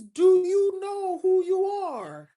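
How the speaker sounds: slowed-down repeat of the same question; deliberate, drawn-out retelling of the mentor; slow mentor's challenge. Retold or imitated. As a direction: drag the question out to make it sink in.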